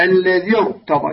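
slow "الذي ارتضى", linked into a thick "rta"; heavy ra. Shown as a demonstration. Teacher gives a Quranic example of incidental kasra, heavy ra. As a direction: slowly join "الذي ارتضى", keeping the ra heavy. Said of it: "هكذا تفخمها".

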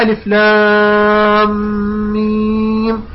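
very long "لام" and "ميم", held on one note; recitation with a stop. Shown as a demonstration. Teacher demonstrates first reading, long steady "لام ميم". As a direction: prolong "ألف لام ميم" and stop after "ميم". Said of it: "الوجه الأول هو بالوقف".

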